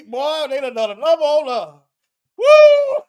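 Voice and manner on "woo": loud, high-pitched whoop; laughing whoop. Real, not acted. Commentator overcome by his own joke, whooping. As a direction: whoop between bursts of laughter.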